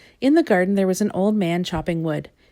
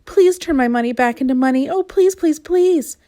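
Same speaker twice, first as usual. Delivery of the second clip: high, pleading beg, louder; hammed-up begging; 8 semitones higher, 4 dB louder than usual. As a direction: plead desperately with the wizard. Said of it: "he begged".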